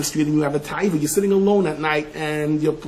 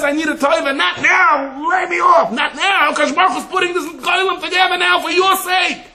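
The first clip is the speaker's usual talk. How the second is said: mock high pleading; 14 semitones higher than usual. Rabbi voicing a tempted caller, high pleading shout. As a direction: cry out in a hammed-up high plea.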